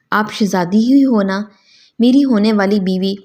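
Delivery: usual speaking voice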